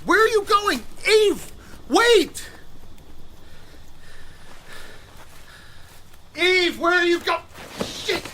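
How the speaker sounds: high urgent calls; calling out. Acted. Man calling after the fleeing woman, urgent. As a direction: yell after her as she runs.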